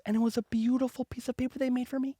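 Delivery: ordinary talk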